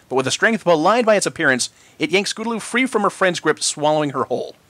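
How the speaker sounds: plain reading voice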